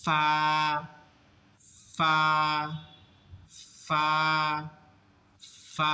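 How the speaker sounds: ফা with air let out on the f before the vowel; airy fa. Shown as a demonstration. Teacher showing the letter ফা, air released first. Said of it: "একটু বাতাস বের করতে হবে".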